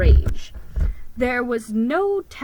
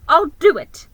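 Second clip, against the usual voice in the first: eager agreement; 7 semitones higher, 10 dB louder than usual. Acted.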